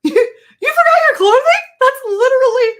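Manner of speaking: very high, loud squeal on the edge of laughter; hysterical disbelief. Retold or imitated. Daughter-in-law on the phone about forgotten clothing, hysterical disbelief. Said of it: "getting ready to just explode with laughter".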